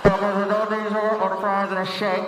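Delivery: muffled intercom employee; drive-thru speaker. Acted. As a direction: talk like a crackly order speaker. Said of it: "They'll come on the speaker".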